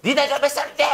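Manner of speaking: high-pitched, scoffing question; mock incredulous challenge. Critic challenging someone about their age, high mock outrage. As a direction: throw it out as exaggerated disbelief.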